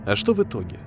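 ordinary delivery

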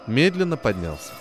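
plain reading voice

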